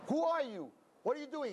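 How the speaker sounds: high alarmed yell at an intruder; retold scream. Rabbi shouting at a man in the ark, high startled yell. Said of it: "he begins to scream".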